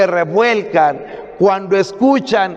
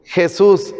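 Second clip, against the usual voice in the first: ordinary talk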